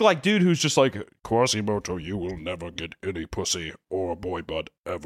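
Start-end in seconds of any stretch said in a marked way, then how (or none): 1.2-5.1 low, quieter mock villain voice; lightly voiced sneering villain; villain quote. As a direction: sneer it like Frollo taunting. Mocking villain quote from film.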